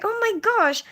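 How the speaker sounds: high, dismayed gasp of realisation; exasperated oh my gosh. Retold or imitated. To her viewers on realising she's late, high exasperated dismay. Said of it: "I'm like".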